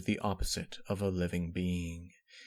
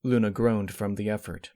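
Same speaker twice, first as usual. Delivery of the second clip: ordinary delivery, nothing marked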